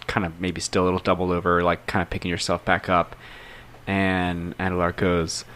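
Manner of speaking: ordinary talk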